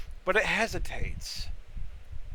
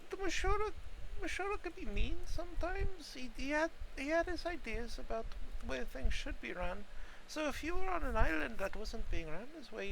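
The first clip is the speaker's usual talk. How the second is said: put-on old mushroom voice; 9 semitones higher, 4 dB quieter than usual. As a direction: elderly voice. Elderly mushroom NPC answers.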